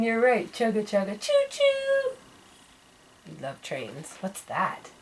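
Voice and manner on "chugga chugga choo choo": chugging, then a high whistle choo-choo; train noises. Shown as a demonstration. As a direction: imitate a train for a toddler. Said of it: "Choo-choo train".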